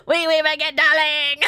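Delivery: high, loud mock French accent breaking into laughter. Reader clowning as the French lover, giggly French accent. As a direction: overdo the French accent while cracking up. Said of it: "how to do it in French accent".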